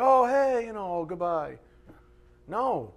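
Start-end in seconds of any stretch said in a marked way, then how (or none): 0.0-1.6 high, mock-casual goodbye; throwaway farewell. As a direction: toss it off as a parting. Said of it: "we treat it little".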